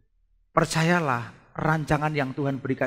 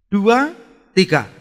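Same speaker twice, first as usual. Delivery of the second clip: loud cue for group reading; calling a count; 8 semitones higher, 12 dB louder, slower than usual. Real, not acted. Cues congregation to read together. (aba-aba lantang)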